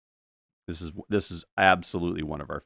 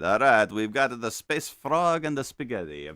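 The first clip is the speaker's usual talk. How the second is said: usual speaking voice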